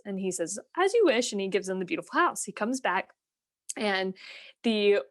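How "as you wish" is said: lifted, grand fish granting a wish; the magic fish answering the fisherman, high and grand. Retold or imitated. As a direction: pitch it up and a touch louder.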